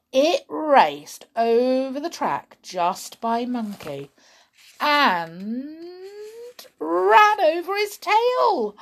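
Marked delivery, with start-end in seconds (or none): 4.8-8.7 high, slow and loud; dramatic. A narrator's reading. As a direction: make the accident big. Storyteller dramatizing the accident.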